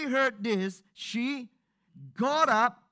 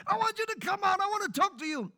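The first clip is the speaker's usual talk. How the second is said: parent shouting through door; 12 semitones higher, faster than usual. Retold or imitated. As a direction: call out to the sulking child, pitched up high.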